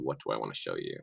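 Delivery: usual speaking voice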